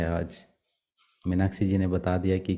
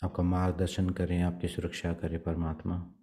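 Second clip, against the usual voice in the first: soft, even meditation voice with long pauses; guided meditation; 5 dB quieter than usual. Acted. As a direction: guide them gently into prayer, leaving long silences. Leads meditation and prayer. (शांत, धीमे स्वर में ध्यान का निर्देशन)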